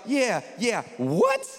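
acted sudden bafflement; baffled double take. Acting out a baffled Bible reader.

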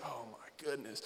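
imitating a quiet, low, exasperated groan of words; hushed exasperation. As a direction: sigh it out softly, as if worn down. Imitates Craig's exasperated reaction.

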